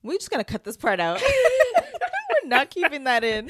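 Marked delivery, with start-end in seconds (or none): 1.3-3.5 high laughing through words. Real, not acted. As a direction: crack up while protesting. Laughing at nose blowing.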